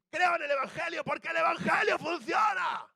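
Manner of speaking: high, loud preacher's roar with the answer; evangelist's shout. Retold or imitated. Evangelist shouting the answer at a huge congregation. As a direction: bellow the punchline to the crowd. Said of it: "pone una voz así y dice".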